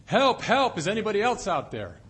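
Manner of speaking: loud, high panicked shout; yelling for help. Acted. Man refusing to let go, shouting loud and high for someone else. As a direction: holler for anyone else, frantic. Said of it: "And finally he yelled".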